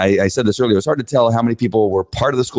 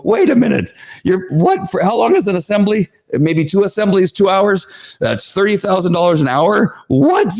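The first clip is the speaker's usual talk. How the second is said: high, incredulous; outraged disbelief; 9 semitones higher than usual. Retold or imitated. Parent retelling the crowd's outrage, high incredulous squeal. Audience reacting in outrage, retold.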